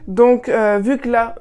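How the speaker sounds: ordinary talk, nothing marked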